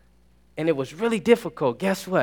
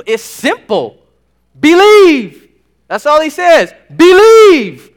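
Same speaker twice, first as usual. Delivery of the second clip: much louder and higher, shouting each "Believe"; full-force preaching; 7 semitones higher, 11 dB louder than usual. Acted. Preacher urging students to simply believe, shouting fervently. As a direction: build to a fervent preacher's cry.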